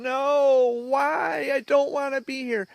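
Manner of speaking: high-pitched, drawn-out wailing protest; retold crying scream. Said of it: "I'd start screaming. I'd start crying".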